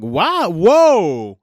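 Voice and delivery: high, loud stunned gasp of a whoa; shocked whoa. Retold or imitated. Overhearing a woman cuss out her husband, stunned. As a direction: react like you can't believe what you just heard. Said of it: "I was like".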